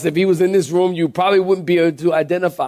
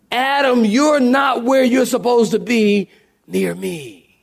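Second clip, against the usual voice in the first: urgent raised call; 6 semitones higher than usual. Shown as a demonstration. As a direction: call him home urgently. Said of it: "In other words".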